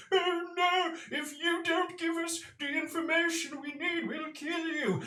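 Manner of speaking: high, hammed-up menacing head; mock threat. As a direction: threaten them in a silly squeaky voice. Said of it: "You hear them saying".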